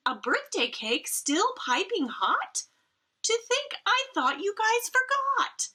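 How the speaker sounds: high, delighted mother's cry; overjoyed, high and bright; excited cry. Acted. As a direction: squeal it with joy. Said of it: "she cried".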